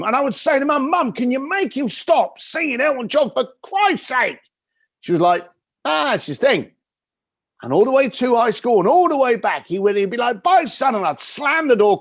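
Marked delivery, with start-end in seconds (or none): 1.1-4.4 put-on whiny teenager. Teenage son begging mum to stop dad singing, whiny. 5.8-6.7 lightly voiced mum. Mum shrugging it off. 10.4-10.9 high, loud cheerful goodbye; cheery dad. Retold or imitated. Dad calling goodbye to his son at school, cheery.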